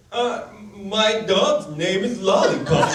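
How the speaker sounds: hammed-up dim voice with a laugh in it; feigned simpleton. Comedian plays dumb to scare off the guys, hammed-up simpleton voice. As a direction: play dumb on purpose to escape.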